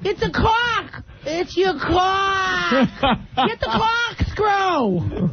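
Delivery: put-on Massachusetts accent. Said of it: "How do you say C A U L K in Massachusetts".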